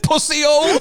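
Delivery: half-laughing, mock-tough insult. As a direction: sneer it through laughter. Mate retells the mugger mocking him.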